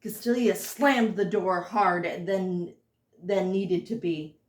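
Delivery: usual speaking voice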